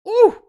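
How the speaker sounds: sharp, very high and loud "ooh" of frustration; angry high yelp. Real, not acted. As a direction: let out a yelp of rising anger. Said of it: "You wanna get me mad?".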